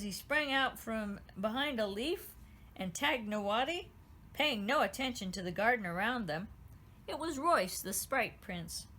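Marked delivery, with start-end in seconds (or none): none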